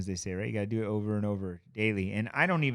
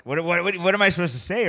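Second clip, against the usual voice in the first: flustered; 6 semitones higher, 7 dB louder than usual. Retold or imitated. Flustered by mentor's question.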